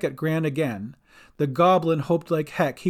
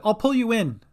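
higher-pitched call; urgent instruction; 7 semitones higher than usual. A narrator's reading. Sister Cat helps Gran aboard, urgent call. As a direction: reassure urgently, voice raised. Calling to swimmer from boat.

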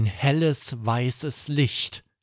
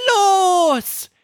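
lauter Startruf; 9 dB louder than usual. Acted. Start der Zauberreise.

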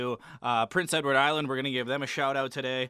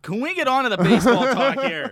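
laughing, loud and high; louder, laughing through the question; 9 semitones higher, 10 dB louder than usual. Real, not acted. Laughing exasperated plea.

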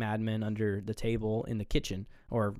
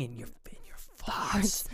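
whispered insult; 7 semitones higher, 6 dB quieter than usual. Retold or imitated. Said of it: "he literally loses it".

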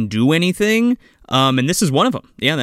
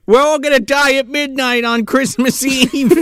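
doom prophecy; 10 semitones higher than usual. Retold or imitated. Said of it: "You're really into this".

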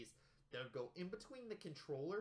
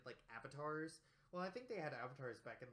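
ordinary delivery

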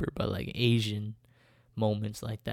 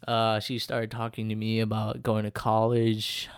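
ordinary delivery

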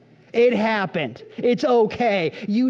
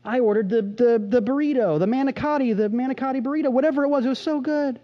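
higher, gushing foodie; excited rave; 7 semitones higher than usual. Retold or imitated. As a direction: rave about the food, higher. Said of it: "you say".

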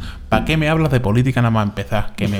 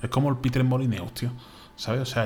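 ordinary talk

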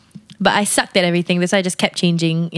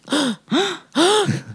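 dramatic gasp; 10 semitones higher than usual. Acted. Dramatic reaction in chat.

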